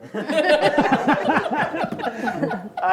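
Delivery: loud high burst of laughter; big laugh. Real, not acted. Director recalling the actress's joke, big laugh. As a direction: throw back and laugh hard, high.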